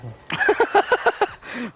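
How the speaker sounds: high burst of laughter; sudden high giggle. Real, not acted. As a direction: crack up at your friend. Laughs as friend spits.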